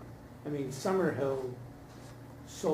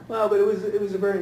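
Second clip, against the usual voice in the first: laughing; 5 semitones higher, 8 dB louder, faster than usual. Real, not acted. Still laughing after the joke.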